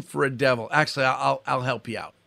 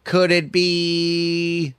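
lightly voiced prim church lady, slow; a hint of the disapproving church lady, drawn out; 3 semitones higher, much slower than usual. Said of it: "He'd say".

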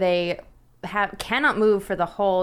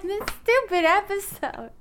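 laughing complaint; 10 semitones higher than usual. Retold or imitated. Laughing mock complaint about episode.

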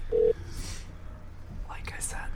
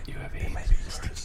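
whisper; 10 dB quieter, much slower than usual. Real, not acted. Whispered prompt to caller.

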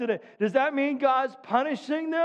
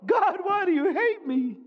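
higher, hammed-up whine; mock whiny complaint; 6 semitones higher than usual. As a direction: whine at God like a spoiled believer. Mocking a complaining believer.

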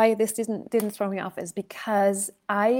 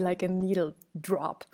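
hushed whisper; 2 semitones lower, 6 dB quieter than usual. Real, not acted. Tense silent room.

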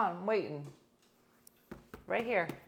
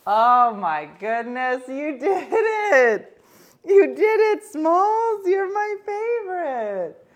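much louder and higher, slow and crying with joy; tearful joy; 9 semitones higher, 13 dB louder, much slower than usual. Real, not acted. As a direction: sob happy praise to the pet. Praising pet after success.

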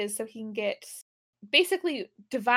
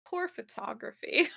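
snooty critique; 6 dB quieter than usual. Retold or imitated. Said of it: "I didn't know you were such a critic".

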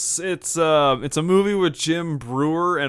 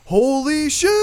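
tin can yell; 9 semitones higher, 4 dB louder, slower than usual. Retold or imitated. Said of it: "yelling in a tin can".

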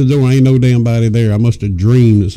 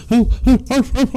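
high imitated arf barks; yappy barks; 12 semitones higher than usual. Dog welcoming owner home, high playful barking. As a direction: yap like a happy little dog. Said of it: "that dog's".

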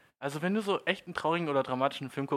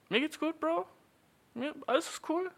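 high, breezy I'm-totally-fine voice; fake fine. Man brushing off crying to his bro in a high, breezy voice. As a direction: pretend all is well in a high chirp.

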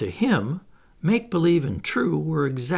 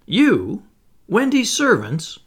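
high, louder incredulous questions; aghast disbelief; 8 semitones higher, 6 dB louder, slower than usual. A narrator's reading. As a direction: throw it back, scandalised. John aghast at being servants.

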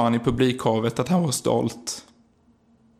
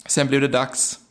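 ordinary talk

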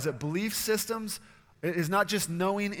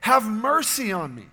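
loud, high desperate cry for mercy; 5 semitones higher, 10 dB louder than usual. Retold or imitated. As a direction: call out to Jesus with everything. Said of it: "he cries out".

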